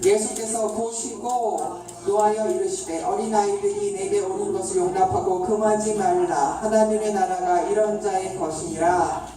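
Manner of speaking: measured, level-toned Bible reading; scripture reading. Acted. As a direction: read the verse aloud in a low, even chant-like tone. Reads the Bible passage aloud.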